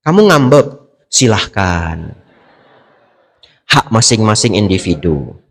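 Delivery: a spouse quoting the monk back, shrugging; mock dismissive quote. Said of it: "kata Bante Abijato". (menirukan dengan nada acuh, pelan)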